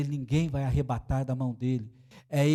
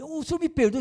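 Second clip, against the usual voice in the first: apologetic father; 14 semitones higher, 6 dB louder than usual. Retold or imitated.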